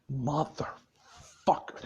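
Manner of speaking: low, quiet, broken-up swearing; retold seething curse. Boss so mad after losing his help, retold seething curse. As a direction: curse under your breath, word by word. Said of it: "he's just so fucking mad".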